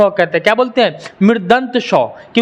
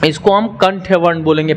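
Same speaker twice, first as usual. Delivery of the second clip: ordinary talk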